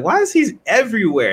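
surprised. Retold or imitated. To herself watching her dog rush around, high surprised cry. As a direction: pitch it way up in surprise. Puzzled at the hyperactive old dog.

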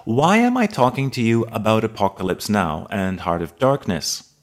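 ordinary delivery

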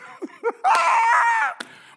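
loud, high burst of laughter. Real, not acted. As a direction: burst out laughing mid-sermon. Preacher laughs loudly mid-sermon.

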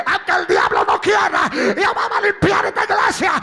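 loud, slow, repeated shouted pleas; full-force preaching. Acted. Pastor preaching at full force, shouting to church.

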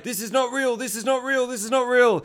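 high, insistent panicked chant; hammed-up repeated frantic plea; mock panic. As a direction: imitate someone waking in denial. Man wakes panicking inside simulation.

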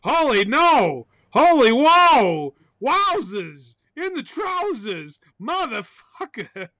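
slow, swooping high whoops; silly runaway excitement; crazy hammed-up exclamations. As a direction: stretch each exclamation out big, then ease off. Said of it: "I am getting too crazy".

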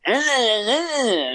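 high mocking eh sounds; a hint of a grumbling boss; imitating the bosses.

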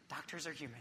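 dropped to a soft murmur; hushed self-reminder; quiet aside. Shown as a demonstration. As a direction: say it softly to yourself, a reminder.

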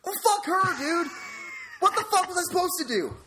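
exasperated high-pitched outburst; worked-up, indignant venting; pissed off. Real, not acted. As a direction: curse in real frustration, voice leaping up. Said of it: "I've never been so fucking pissed off".

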